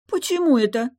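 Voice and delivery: high, loud, miffed question; offended mermaid. A narrator's reading. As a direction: pout it in a high voice. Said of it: "обиженно поджала губы".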